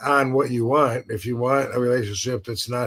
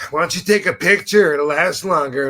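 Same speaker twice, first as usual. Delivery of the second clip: higher, louder imitation of a jealous ex; mimicked jealous snap; 5 semitones higher, 5 dB louder than usual. Imitating his evil ex catching him looking, jealous sarcasm. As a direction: throw it out as a sarcastic jab. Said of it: "she'd be like".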